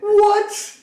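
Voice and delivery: high, loud shocked "what"; startled outburst. Acted. Player reacting to a dropped crystal, loudly startled. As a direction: burst out in loud, high-pitched disbelief.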